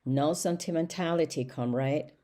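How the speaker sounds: ordinary delivery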